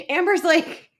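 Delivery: normal talking voice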